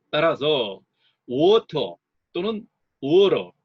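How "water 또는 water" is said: water drawn out slowly, WA loud and strong; teacher's slow, loud stress demo. Shown as a demonstration. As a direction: stretch water, hitting the first syllable. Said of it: "첫 음절에 강세가 옵니다".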